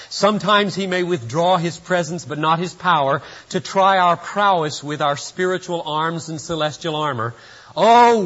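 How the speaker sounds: fiery revival crescendo; rousing preaching. Acted. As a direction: preach it at full force like a battle cry. Reading letter as climactic preaching.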